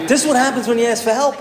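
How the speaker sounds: high, loud, fast gripe; exasperated complaint. Retold or imitated. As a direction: rattle it off in mock outrage.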